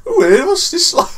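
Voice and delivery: high, loud mock Dudley accent. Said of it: "I know it's a Dudley accent".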